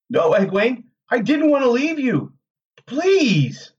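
high, distressed pleading; desperate pleading. A narrator's reading. Rand begging Egwene's apparition to understand, desperate plea.

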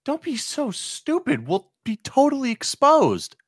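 high, alarmed protest; panicked. Acted. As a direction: object in a panic. Panicked argument at the pub.

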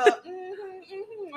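high, soft mimicked mm-hmm; self-important administrator. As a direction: imitate someone acting like the boss. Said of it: "making it sound like he's the".

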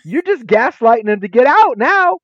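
exasperated half-joking rant; indignant callout. Retold or imitated. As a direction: call out the gaslighting. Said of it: "And I'm like".

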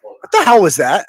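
a loud, half-laughing burst of shock; startled laughing shout. Real, not acted. Host reacting live to a strange clip, startled laughing shout. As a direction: blurt it out, caught off guard and laughing.

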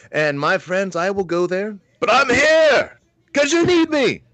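high New York preacher voice building to a loud, drawn-out cry; New York priest accent. Retold or imitated. Priest answering the parish, hammed-up high New York accent. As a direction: imitate a New York priest ringing out his defiance. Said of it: "New York accent".

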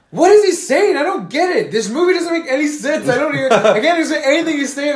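loud, high exasperated complaint; retold outburst. Mother in the theater, confused and loudly complaining. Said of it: "And she was like".

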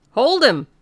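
high, loud order; loud command. Acted. Constable Jaffers calling the men to grab the stranger, loud shout. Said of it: "said Jaffers loudly".

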